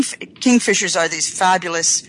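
usual speaking voice

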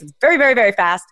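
ordinary talk

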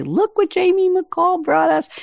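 high, put-on playful voice; playful show-off. Host imagining showing the guest's quote to others, high playful mock boast.

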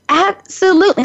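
high, sing-out mock assurance; eager reply. Speaker assures a friend her story is quick, eager and high-pitched. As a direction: imitate your own breezy, over-confident yes.

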